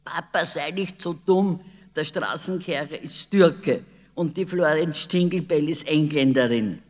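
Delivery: put-on loud, high girl calling to her father; loud and high, scolding Papa. A narrator's reading. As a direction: call across the room, bossy and sure. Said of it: "Sissi rief".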